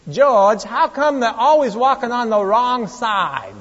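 exaggerated New York accent, whining. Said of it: "Accent just drives me crazy".

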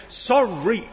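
put-on a sulky, grudging child's sorry with a rising end; defiant sorry. Shown as a demonstration. Preacher mimics a child forced to apologise, defiant. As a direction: toss the word off like a stroppy kid. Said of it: "There's the defiant sorry".

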